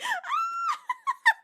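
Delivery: loud high burst of laughter; real shriek of a laugh. Real, not acted. Cracking up at a viewer's comment, shrieking laugh.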